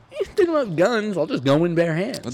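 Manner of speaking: high-pitched mock burglar; imagined burglar. Burglar deciding to go unarmed, mock casual role voice. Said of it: "they're not just gonna be like".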